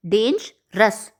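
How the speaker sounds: slow डेंजरस with each syllable drawn out; syllables spelled out. Shown as a demonstration. Teacher to students counting syllables: slow drawn-out word. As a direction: stretch डेंजरस slowly to count its parts. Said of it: "more than one syllable".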